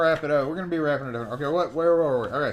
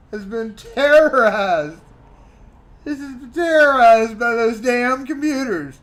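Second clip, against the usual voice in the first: mock outrage; 6 semitones higher, 8 dB louder than usual. Host mocking a reader's complaint about computers, hammed-up outrage. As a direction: play up the drama of it.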